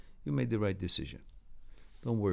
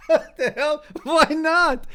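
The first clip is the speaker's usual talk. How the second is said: high, loud laughing protest; 1 high laughter; 8 dB louder, slower than usual. Real, not acted. Brushing off critics, laughing high and loud.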